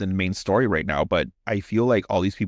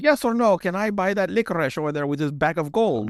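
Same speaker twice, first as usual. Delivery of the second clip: put-on high, comic German accent; impatient German customer, pitched up; hammed-up high German accent; 8 semitones higher than usual. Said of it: "You sound like Ilya".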